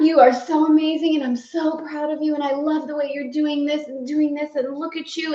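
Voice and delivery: high, bubbly gushing over herself; lavish herself with delighted praise; gushing self-praise. Retold or imitated. Woman in her kitchen gushing over herself, high and thrilled. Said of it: "I just let myself have it".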